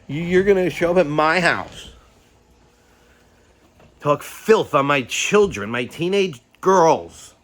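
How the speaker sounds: furious yelling. Retold or imitated. Angry dad retelling his yelling at trolls, furious. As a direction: hammer each line at them.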